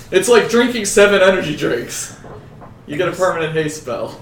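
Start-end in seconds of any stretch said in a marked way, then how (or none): 0.1-2.2 higher, louder teasing parrot; mocking imitation. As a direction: mimic the friend to tease. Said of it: "Coming from the fucking health professional".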